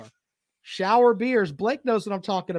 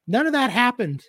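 normal talking voice

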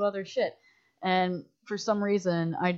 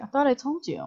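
high teasing deadpan; mock sly boss; 8 semitones higher, 4 dB louder than usual. Director teasing crew about deferred pay, high and sly. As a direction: play the sly tease with a lifted voice. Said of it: "No, I'm just kidding".

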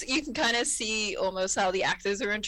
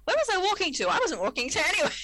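high, giggly confusion; puzzled and amused, pitched up; confused Beverly; 8 semitones higher, faster than usual. Retold or imitated. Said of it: "at the camera and goes".

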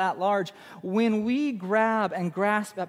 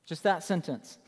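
ordinary talk

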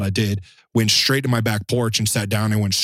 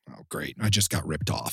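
low, much softer dry sarcasm; deadpan sarcasm; 5 semitones lower, 7 dB quieter than usual. Retold or imitated. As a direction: mutter it like you expected the scam. Thinks winner is a scammer.

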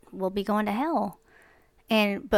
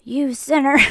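loud, higher-pitched "You sinner"; hammed-up condemnation, louder and higher; mock accusing shout; 5 semitones higher, 9 dB louder than usual. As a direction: bark the accusation in mock outrage. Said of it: "I'm not gonna keep being like".

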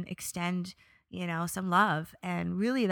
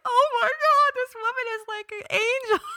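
much higher, louder delighted squeal; high, loud thrilled gush; overjoyed gush; 9 dB louder than usual. Retold or imitated. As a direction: gush as if meeting an angel. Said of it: "And I was like".

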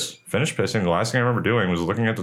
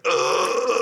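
groan; 10 semitones higher than usual. Retold or imitated. Groan on bathroom floor.

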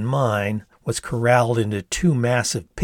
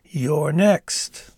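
theatrical; 5 semitones higher than usual. A narrator's reading. Theatrical pointing at narrator.

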